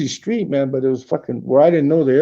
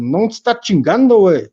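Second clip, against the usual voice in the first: punchy Mexico City Spanish, a bit louder; Mexico City slang; 5 semitones higher, 6 dB louder than usual. Retold or imitated. Telling off a man following him, in Mexico City slang. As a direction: snap it out in chilango street slang. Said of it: "I had to tell him in Mexico City dialect".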